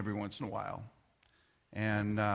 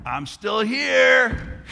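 higher, slow, loud taunting yell; a child shouting down from the bedroom above, defiant yell; 10 semitones higher, 13 dB louder, much slower than usual. Retold or imitated. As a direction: shout it through the floor like a defiant kid.

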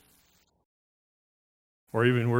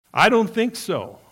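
emphatic denial; 8 semitones higher, 7 dB louder than usual. Real, not acted. Preacher rejecting the doubt.

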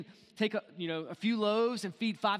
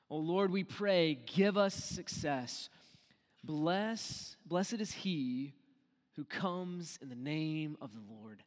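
slow scripture reading; 3 semitones lower, much slower than usual. Real, not acted. Psalm read slowly.

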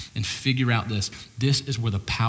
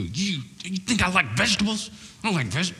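a hammed-up gruff scolding, pitched up; mock angry God; 7 semitones higher than usual. Pastor hams up an angry God scolding Cain. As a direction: playfully fake a furious God. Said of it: "take Cain behind the woodshed and go".

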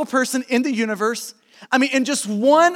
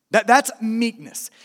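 ordinary talk

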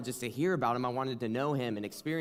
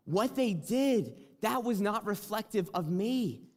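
higher, gentle voice of God answering; voice of the Lord; 6 semitones higher than usual. Retold or imitated. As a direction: let the Lord's words come high and tender. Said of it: "the Lord just spoke to me".

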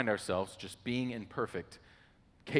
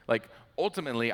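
usual speaking voice

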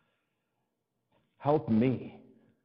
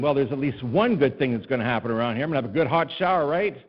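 hopeful mock cheer; 5 dB louder than usual. Pastor cheering himself on about a hot shower, rising to a shout. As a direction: talk yourself into a good hot shower.

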